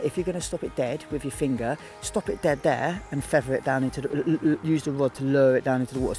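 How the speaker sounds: ordinary talk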